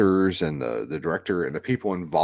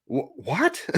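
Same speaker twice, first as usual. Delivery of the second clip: confused what; 8 semitones higher than usual. Retold or imitated. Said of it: "Tom Holland just looks at him and goes".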